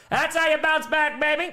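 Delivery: hyped celebration. Real, not acted.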